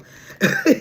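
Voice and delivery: sudden high shriek of laughter; cracking up, high and loud; burst of laughter. Real, not acted. Reader laughing loudly at her own reading.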